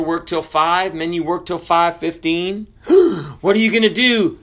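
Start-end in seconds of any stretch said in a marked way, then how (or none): 2.9-4.4 mock gasp. Mock shock at boss.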